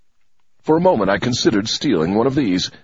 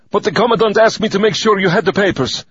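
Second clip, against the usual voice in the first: breathless, much higher and louder; gasped; 12 semitones higher, 4 dB louder than usual. Acted. Winded fake messenger to the major, gasping and urgent. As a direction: gasp it out, hurried and winded. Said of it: "I gasped".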